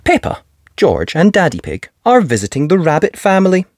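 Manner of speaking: plain reading voice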